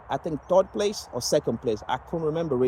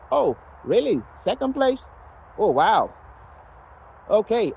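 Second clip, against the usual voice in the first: a pitched-up, unimpressed echo of the aunt's surprise; mock surprised aunt; 7 semitones higher than usual. Retelling his aunt belittling his second place, mock-surprised. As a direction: voice jumps high on "Second place?" in sarcastic disbelief.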